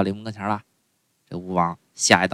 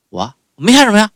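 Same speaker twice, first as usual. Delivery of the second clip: flustered bystander; 12 semitones higher, 8 dB louder than usual. Acted. Bystander answers, acted crowd scene.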